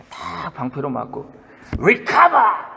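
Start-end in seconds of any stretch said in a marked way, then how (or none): 1.8-2.7 higher, louder hero shout; mock battle cry. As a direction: yell it like a movie warrior. Movie hero shouting after shield hit. (영화 속 전사처럼 외치기)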